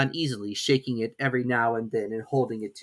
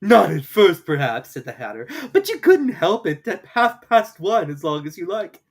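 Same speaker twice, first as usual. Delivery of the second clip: louder, higher teasing; Hatter; 6 semitones higher, 8 dB louder than usual. A narrator's reading. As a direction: tease her. Hatter voiced.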